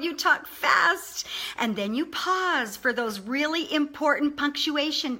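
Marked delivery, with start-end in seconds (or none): 0.0-1.3 high, drawn-out emphasis on FAST; enthusiastic. Shown as a demonstration. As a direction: stretch it out slowly with high-pitched enthusiasm. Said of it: "just really be enthusiastic".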